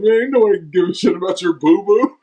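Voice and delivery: high-pitched mocking dismissal; retold scoffing. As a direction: imitate a teammate scoffing at a complaint. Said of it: "I think somebody goes".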